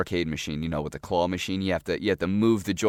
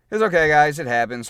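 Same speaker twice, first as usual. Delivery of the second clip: 1 brushing it off; 7 semitones higher, 9 dB louder than usual. Real, not acted. Shrugging off the missed shot.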